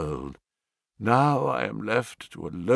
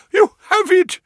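high, loud, gasped exclamation; gasping joy; 7 dB louder than usual. Acted. Banker seeing his lost gems, a high joyful gasp. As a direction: gasp it out in disbelief. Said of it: "he gasped".

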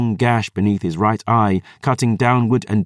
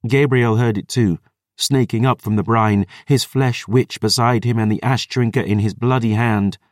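ordinary delivery, nothing marked

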